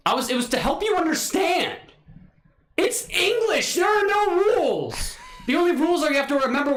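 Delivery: high-pitched exasperated rant; exasperated outburst. Pretend. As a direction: protest at the top of your range. Exasperated defence of English pronunciation.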